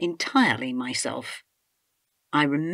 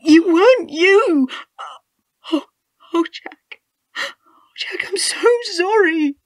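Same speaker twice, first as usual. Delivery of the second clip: much higher, louder wailing sobs; sobbing anguish; 7 dB louder than usual. Acted. Wife sobbing apologies over her dying husband, wailing. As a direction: wail your apology through tears.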